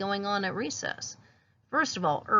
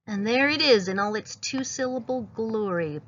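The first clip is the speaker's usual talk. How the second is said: ordinary talk, nothing marked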